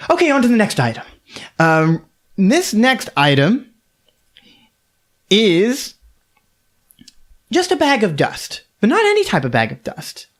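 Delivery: brighter auctioneer. Acted. Auctioneer perking up to present the next item. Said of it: "She goes".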